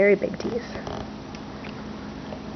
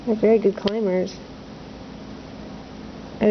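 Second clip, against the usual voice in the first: ordinary talk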